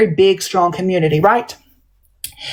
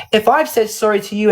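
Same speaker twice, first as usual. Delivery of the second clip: normal talking voice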